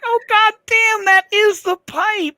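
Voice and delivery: retold horror at the dropped pipe; shocked realization. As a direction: relive the moment it dawns on you. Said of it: "I'm like".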